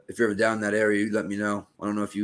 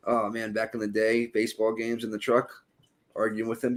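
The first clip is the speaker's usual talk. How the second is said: usual speaking voice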